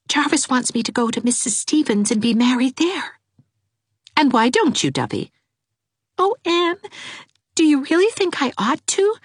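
put-on a timid girl begging for help; Dovey's voice. A narrator's reading.

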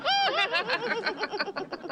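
high, tittering laugh; high-pitched gleeful titter; teasing laughter. Acted. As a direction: giggle at your own jibe. Laughing at own jailbird joke.

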